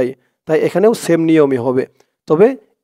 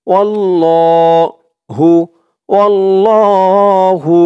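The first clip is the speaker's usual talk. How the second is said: slow, drawn-out Quranic chant; tajweed recitation; 3 semitones higher, 4 dB louder, much slower than usual. Shown as a demonstration. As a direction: recite it slowly with long held vowels. (ধীরে টেনে কুরআন তিলাওয়াত)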